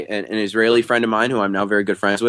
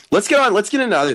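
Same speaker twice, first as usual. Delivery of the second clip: squeaky voice; 12 semitones higher, 3 dB louder, much faster than usual. Pretend. Sudden high squeak.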